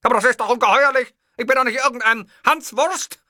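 outraged Hitler. Acted. Hitler, outraged at the clerk, loud. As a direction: burst out in wounded pride.

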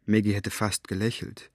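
usual speaking voice

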